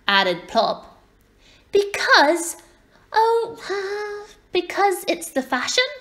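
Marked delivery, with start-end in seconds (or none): 1.7-5.9 put-on high, halting child's voice; falter, then blurt it out high; flustered little girl. A narrator's reading. Little girl hunts for a reason, flustered and high.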